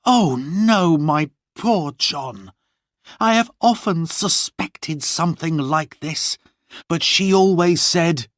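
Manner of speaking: grieving for poor John; Lorna dismayed. Acted.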